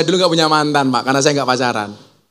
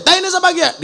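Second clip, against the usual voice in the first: high mock yell; yelling the question in jest. Ngapak man yells at the Sundanese villager, mock yell. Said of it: "Orang ngapak teriak".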